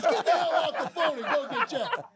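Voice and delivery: loud, high imitated yell; relentless. Boss ordering manager off the phone, furious. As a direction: blow up like an exasperated boss. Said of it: "he was relentless".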